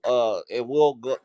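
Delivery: ordinary delivery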